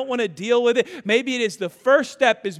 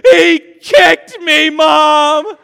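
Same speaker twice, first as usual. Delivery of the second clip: put-on loud, high, drawn-out child's wail; tearful mock scream; 5 semitones higher, 13 dB louder, much slower than usual. Dad imitating son tattling to mom, screaming through tears. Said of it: "he screams through bloody tears".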